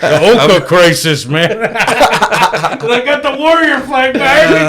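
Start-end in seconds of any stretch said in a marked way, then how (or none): 1.5-4.6 laughing. Real, not acted. Laughing intro to podcast.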